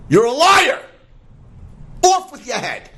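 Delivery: high, loud outraged shout; furious lion king. Acted. Lion king condemning the lying sheep, furious shout.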